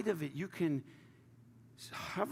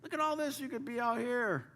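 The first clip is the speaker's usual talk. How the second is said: much higher mock call to an ant; mock yell at an ant; 8 semitones higher than usual. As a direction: playfully call down to a tiny ant. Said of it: "You're screaming at that ant".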